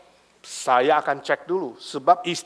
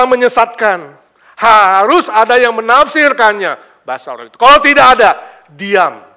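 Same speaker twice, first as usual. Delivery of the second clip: forceful preaching; 6 semitones higher, 14 dB louder than usual. Acted. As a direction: drive the point home from the pulpit. Preaching the main point.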